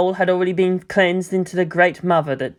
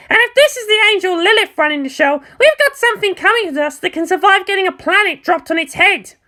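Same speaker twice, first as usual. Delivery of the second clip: put-on higher, cocky, blunt girl voice; brash pilot Asuka; 3 dB louder than usual. Asuka telling Mayumi it's not her battlefield, higher brash voice. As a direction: voice Asuka high and sure of herself, talking down.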